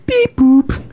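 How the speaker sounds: high two-note electronic beep imitation; scanner beep. Gym-goer imitating the fob scanner rejecting him, high beeps. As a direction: mimic a scanner's error tones, high. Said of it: "Scan it again".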